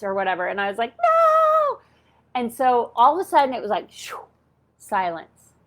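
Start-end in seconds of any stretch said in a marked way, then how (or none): 1.0-1.8 a much higher squeaked no; a high, petulant refusal; high defiant no. Retold or imitated. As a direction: refuse them flatly, pitched way up. Refusing the angel voices.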